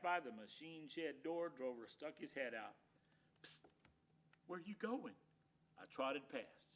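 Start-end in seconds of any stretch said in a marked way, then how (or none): 3.4-5.2 whispering. Acted. Dog sneaking out of shed.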